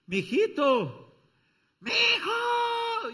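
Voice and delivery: very high, drawn-out calling shout; mother calling loudly. Acted. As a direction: holler for her son through the wall. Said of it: "ahí están las mamás".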